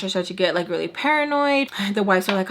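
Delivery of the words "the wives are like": ordinary delivery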